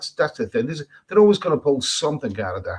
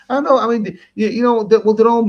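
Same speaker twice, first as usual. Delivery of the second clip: excited outburst; 10 semitones higher, 7 dB louder than usual. Real, not acted. Bursts in reacting to comment.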